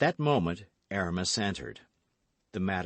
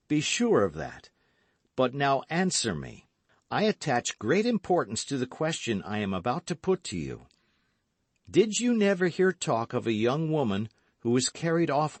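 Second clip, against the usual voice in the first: lightly voiced earnest hero, raised pitch; earnest gallant young man; 7 semitones higher, 4 dB louder than usual. A narrator's reading. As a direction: press the question, a little raised. D'Artagnan questions Kitty.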